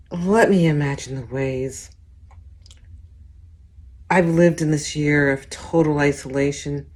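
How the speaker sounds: usual speaking voice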